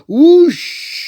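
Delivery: high, loud sound effect; whoosh. Acted. Narrator voicing the fall into the case, high whoosh. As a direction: sweep the word up like a rush of wind.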